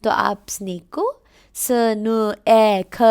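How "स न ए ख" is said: स न ए ख sounded letter by letter, loud; teacher showing how not to read snake; wrong sound-out. Shown as a demonstration. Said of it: "ए नहीं पढ़ोगे".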